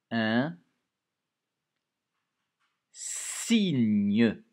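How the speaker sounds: "Un signe" loud and drawn out, "signe" held long; loud stretched dictation word; dictation emphasis. Shown as a demonstration. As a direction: dictate "signe" slowly and loudly. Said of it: "Deux syllabes".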